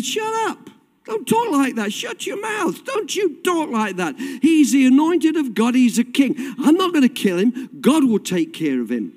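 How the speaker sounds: high-pitched acted rebuke; indignant David, rebuking. David tells his men not to talk of killing, indignant rebuke. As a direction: preach it as a firm, scolding refusal.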